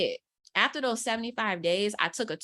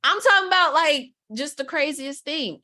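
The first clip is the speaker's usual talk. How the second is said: excited; 6 semitones higher, 6 dB louder than usual. Real, not acted.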